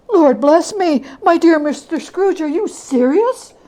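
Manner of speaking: put-on a stout old man who can't believe his ears; astonished gentleman. The gentleman to Scrooge on the donation, astonished.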